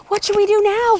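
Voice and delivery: high loud holler. Acted. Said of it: "he hollered".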